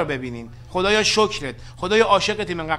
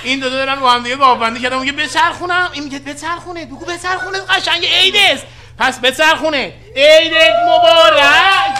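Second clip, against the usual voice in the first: hammed-up excitement; 7 semitones higher, 9 dB louder than usual. Hamming up conspiracy, holiday wish.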